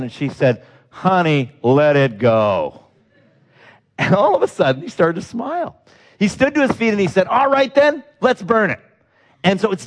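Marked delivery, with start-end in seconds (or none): 0.9-2.8 slow, tender, lower; gentle plea. Retold or imitated. Wife gently calms her husband. 7.3-8.8 higher, bright burst of resolve; sudden eager agreement. Retold or imitated. Man suddenly agrees, smiling.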